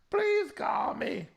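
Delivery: high, slow mock plea; hammed-up begging Please call me. Host begging the singer to call, high and slow.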